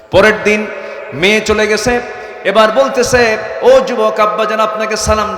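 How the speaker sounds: high, loud sermon cry; impassioned high-pitched preaching; full-force preaching. Acted. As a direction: raise the voice and proclaim it to the crowd.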